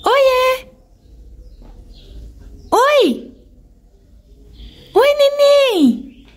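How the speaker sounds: squeaky high pet talk. Real, not acted. Greeting the birds in baby talk.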